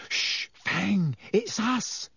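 calm the barking hound; hushing Hermione. A narrator's reading.

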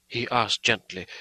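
ordinary delivery

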